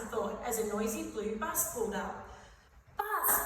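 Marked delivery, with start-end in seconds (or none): 3.0-3.4 high-pitched little cry of delight; happy exclamation. A narrator's reading. Fart seeing the bus he wants to ride, happy. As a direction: pipe up happily at a treat. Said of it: "thought Fart happily".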